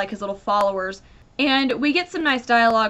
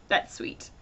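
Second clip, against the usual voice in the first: whispered aside; 9 dB quieter than usual. Real, not acted. Unvoiced aside.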